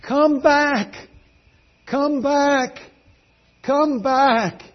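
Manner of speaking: high, drawn-out call; retold crowd shout. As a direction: call out like a crowd pleading after someone. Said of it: "They're shouting".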